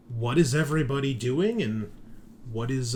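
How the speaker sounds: normal talking voice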